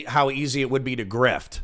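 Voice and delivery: ordinary talk, nothing marked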